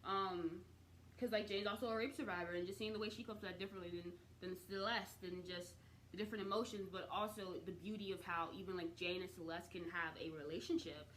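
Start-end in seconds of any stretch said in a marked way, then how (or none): none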